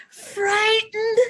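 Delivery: high, scared squeak on frightened; teacher acting out fear for children; acted frightened. Reading emotion words with expression.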